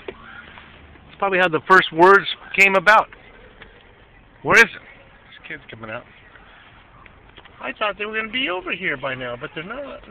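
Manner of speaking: normal talking voice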